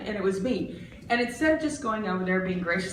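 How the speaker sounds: normal talking voice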